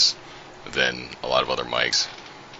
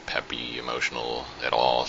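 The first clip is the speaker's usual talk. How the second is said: ordinary talk, nothing marked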